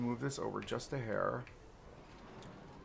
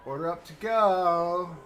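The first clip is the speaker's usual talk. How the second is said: slow, loud, higher diner order call; 4 semitones higher, 8 dB louder, slower than usual. Acted. Streamer calling an order at the restaurant, slow and louder. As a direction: sing out the order like a cook.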